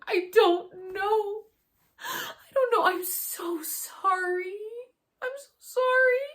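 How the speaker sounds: high, loud, slow sobbing plea; imitating bawling. To friends in her vision, a high loud sobbing apology.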